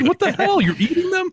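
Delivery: squeaky high disbelief; high-pitched outrage. Retold or imitated.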